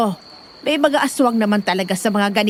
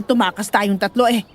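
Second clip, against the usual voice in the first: ordinary talk, nothing marked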